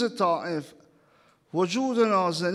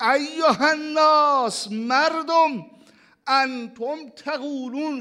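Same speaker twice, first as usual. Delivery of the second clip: impassioned preaching; 5 semitones higher than usual. Acted. Preacher voicing the Prophet addressing the people, fervent with a sob. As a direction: declaim it, voice catching.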